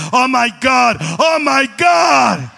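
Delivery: loud, very slow shouted "oh my God"; amazed gringo. Retold or imitated. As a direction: shout it out stunned. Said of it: "el gringo decía". (gringo gritando asombrado, muy lento)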